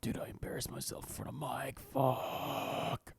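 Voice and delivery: soft embarrassed whisper; quiet ashamed muttering; whispered inner thought. Retold or imitated. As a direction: hush it like a private thought.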